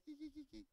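high, soft mock giggle; imitated giggle. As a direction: titter in a tiny put-on voice.

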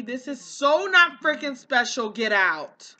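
loud, fed-up yell to clear the room; real frustrated yelling; exasperated shout. Real, not acted. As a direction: shout them out of the house. Yelling at sims crowding the house.

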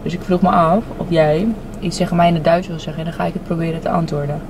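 ordinary talk

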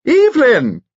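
high, loud call across a ballroom; crying out. A narrator's reading. Old friend hails Lady across the ballroom, crying out. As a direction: sing out the name in a high, delighted cry. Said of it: "cried Lady Allen".

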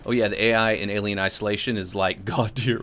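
usual speaking voice

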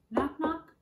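high, loud knocking call; door knock. Acted. Teacher knocks like a hand on a door for kids. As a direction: rap it out like knuckles on a door. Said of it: "like a hand on a door".

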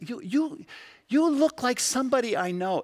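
a stranger caught by a familiar face; surprised onlooker. Retold or imitated. Strangers noticing God's likeness in us, surprised high voice.

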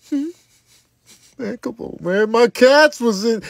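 ordinary talk

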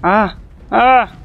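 sudden high startled yelps; screaming while falling. Real, not acted. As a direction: yelp as you lose footing. Falling while creature attacks.

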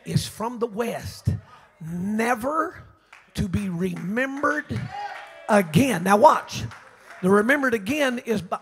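loud, drawn-out pulpit preaching; a revival preacher hammering each phrase home; fiery preaching. Acted. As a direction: preach it out to the back pew. Preaching at full force.

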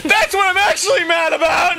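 high, half-laughing protest; mock indignation. Friend playing the crying son, mock indignant high protest. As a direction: play an indignant tearful kid.

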